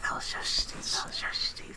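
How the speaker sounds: slow hushed whisper. Acted. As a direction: whisper it slowly, eerily. Whispered running joke.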